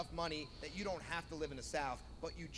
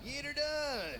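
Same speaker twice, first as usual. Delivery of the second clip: Larry catchphrase; 7 semitones higher, slower than usual. Retold or imitated. Larry the Cable Guy catchphrase.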